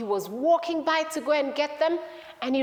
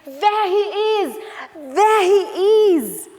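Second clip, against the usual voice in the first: loud, high shout with drawn-out words; high excited holler; excited calling out; 8 semitones higher, 9 dB louder, much slower than usual. Acted. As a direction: call it out like you've spotted someone lost. Sister calling out on finding him.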